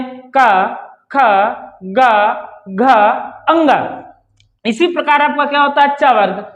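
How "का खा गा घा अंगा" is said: "खा, गा" drawn out slowly from the throat; slow throat demo. Shown as a demonstration. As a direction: stretch "का", "खा", "गा" and push air from the throat. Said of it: "कंठ से वायु निकलती है". (कंठ से धीमा, खिंचा हुआ उच्चारण)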